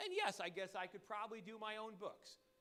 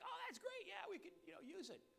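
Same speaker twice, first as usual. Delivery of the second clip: high, airy mock cheer; polite fake enthusiasm; 10 semitones higher, 4 dB quieter than usual. Answering the giddy bookkeeper with much higher put-on cheer. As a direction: sound pleased to humor her. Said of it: "And I'm like".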